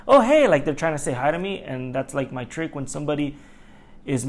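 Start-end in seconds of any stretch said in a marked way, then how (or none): 0.1-0.5 much higher, louder sing-song greeting to annoy; fake friendly hello. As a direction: greet the angry driver like an old friend. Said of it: "I'll just wave at 'em like".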